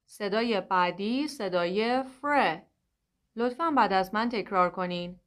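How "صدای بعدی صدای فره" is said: ordinary delivery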